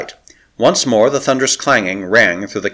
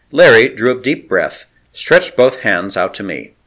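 ordinary delivery, nothing marked

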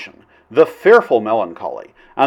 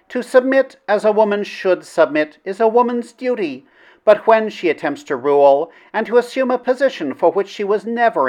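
princess voice; 6 semitones higher than usual. Shown as a demonstration. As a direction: lift into a gentle princess voice. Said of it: "Gotta do the princess voice".

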